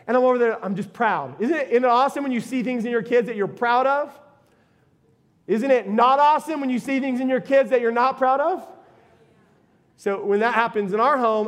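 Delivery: ordinary delivery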